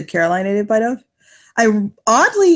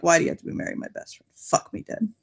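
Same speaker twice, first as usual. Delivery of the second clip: hushed aside; 4 semitones lower, 8 dB quieter than usual. Acted. Character mutters aside.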